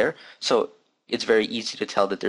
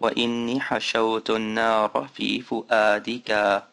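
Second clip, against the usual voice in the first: whole Arabic line read fast and natural; natural-speed Arabic reading; normal speed; much faster than usual. Shown as a demonstration. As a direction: read it at full everyday speed. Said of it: "at normal speed".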